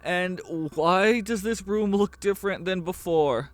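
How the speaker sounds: high, loud, rattled questioning; Armando alarmed. Acted. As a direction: raise the voice in alarm at the gargoyle. Narrator voicing Armando.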